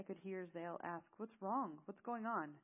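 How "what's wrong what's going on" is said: urgent demand. Acted. Zale confronts the upset pair.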